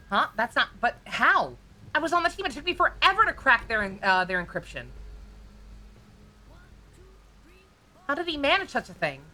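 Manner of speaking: shocked character. Acted. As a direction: react as if the impossible just happened. Voices a shocked game character.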